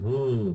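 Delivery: high, swooping "ooh"; drawn-out "ooh". Retold or imitated. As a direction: let out a long ooh that arches up and back down. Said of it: "I said it like that".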